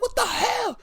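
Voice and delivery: very high, fast, loud squealing complaint; high-pitched outraged wrestler voice; Bianca. Retold or imitated. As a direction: shriek it like a wrestler kicked in the face. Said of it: "She's like".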